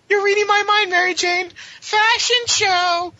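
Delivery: hammed-up giddy girlfriend voice; girly squeal. Gwen answers Mary Jane's fashion show idea, high and giddy.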